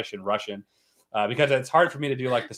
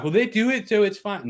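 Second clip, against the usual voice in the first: high, whiny mock of their excuse; mocking quote; 9 semitones higher, 4 dB louder than usual. Mimicking channels who justify posting full movies, high and mocking. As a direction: toss off the excuse in a silly, high voice. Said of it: "and they're like".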